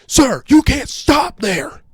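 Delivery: high, loud, slow shout; mock yelling. TSA officer to dawdling old man, yelling. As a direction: shout it word by word at him. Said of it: "T S A is yelling".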